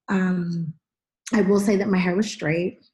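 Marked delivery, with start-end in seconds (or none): none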